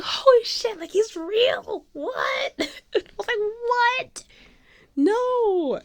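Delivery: very high, drawn-out squeals; starstruck disbelief. Retold or imitated. Fan seeing her idol is real, squealing disbelief.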